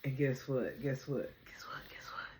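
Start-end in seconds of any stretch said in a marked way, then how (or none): 1.4-2.3 whispered, teasing echo; hushed repeats. Real, not acted. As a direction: drop to a whisper, like sharing a secret. Playful whispered repeats of a phrase.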